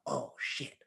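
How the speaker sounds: breathless whispered curse; whispered oh shit. Retold or imitated. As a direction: whisper it in shock. Shocked realization, whispered.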